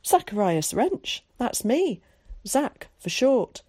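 lightly voiced well-spoken boy; cultured boy. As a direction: present your name with relish. Zack introduces himself.

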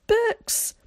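high, thrilled exclamation of books; a boy's squeal of delight at a find; delighted cry. A narrator's reading. Boy unpacking the case shouts his glee at books, high and thrilled.

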